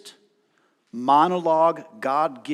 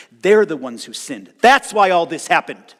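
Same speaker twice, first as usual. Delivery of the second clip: accusing friends; 2 semitones higher, 11 dB louder than usual. Retold or imitated. Friends blaming Job, acted quote.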